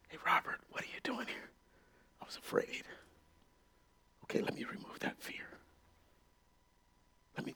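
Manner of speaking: tender whispered dialogue; hushed intimate whisper. Acted. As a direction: breathe it softly. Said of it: "he whispers".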